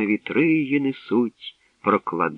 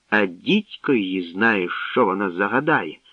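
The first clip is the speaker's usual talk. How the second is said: ordinary delivery, nothing marked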